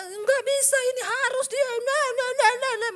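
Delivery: high, loud mimicry of stubborn protest; mock frantic insisting. Preacher mimicking people who insist it cannot be, frantic mock protest. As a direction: rattle out a high-pitched, insistent objection.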